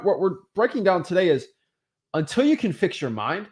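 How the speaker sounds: ordinary talk, nothing marked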